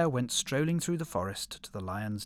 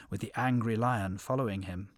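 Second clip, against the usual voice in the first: usual speaking voice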